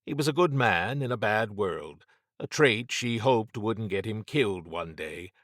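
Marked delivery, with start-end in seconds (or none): none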